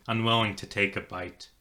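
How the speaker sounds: ordinary delivery, nothing marked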